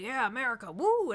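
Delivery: high, loud mock cheer building to a whooping woo; hyped cheer. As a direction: cheer like an overexcited dad watching the news. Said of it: "my dad was, like, all hyped".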